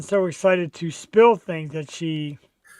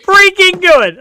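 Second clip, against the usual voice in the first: thrilled; 12 semitones higher, 11 dB louder than usual. Real, not acted. Husband telling the host about his surprise party, excited. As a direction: gush about how badly you were fooled.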